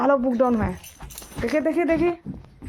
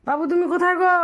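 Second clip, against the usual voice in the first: higher, playful where-are-you call; 7 semitones higher, 3 dB louder than usual. Pretend. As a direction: call out to a hiding child. Calling for hiding child.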